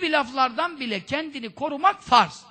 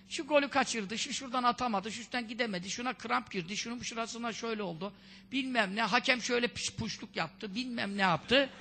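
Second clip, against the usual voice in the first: idle chatterer; 8 dB quieter than usual. Retold or imitated. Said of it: "Geveze geveze böyle".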